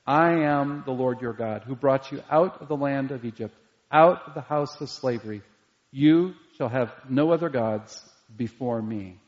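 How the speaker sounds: solemn scripture reading. Acted. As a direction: read the commandment with weight.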